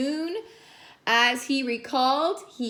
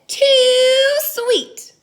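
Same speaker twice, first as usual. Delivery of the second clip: loud, high too sweet; loud disgusted complaint; 13 semitones higher, 9 dB louder than usual. Acted. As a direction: complain about the sugar loudly. Kyle disgusted by sugar.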